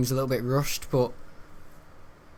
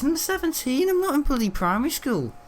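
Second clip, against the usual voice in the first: indignant; 12 semitones higher than usual. Real, not acted. Indignant about being treated young.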